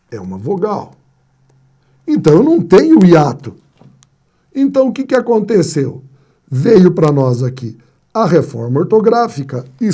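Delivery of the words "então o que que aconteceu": ordinary delivery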